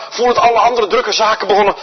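normal talking voice

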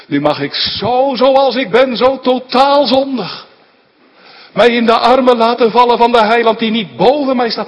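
high, loud, rising sermon climax; preaching at full force. Real, not acted. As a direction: build up and proclaim it to the congregation. (vurig preken, luid en opzwepend)

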